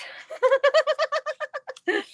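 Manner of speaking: high, sustained burst of laughter; laughing. Real, not acted. Crafter amused at using her kids' slang, laughing. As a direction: crack up at your own joke.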